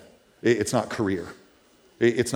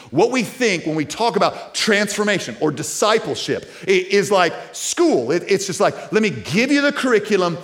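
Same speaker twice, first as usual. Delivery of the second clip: high, loud, ringing preacher's cry; rising preaching at full throttle; full-force preaching; 8 semitones higher, 6 dB louder than usual. Real, not acted. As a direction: lift the room with wonder and conviction.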